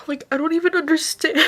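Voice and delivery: overwhelmed and close to crying; tearful. Real, not acted. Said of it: "bawling my eyes out".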